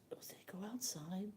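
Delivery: worried secret whisper; whispered question. Retold or imitated. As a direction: whisper it so he can't hear. Secret question about her son.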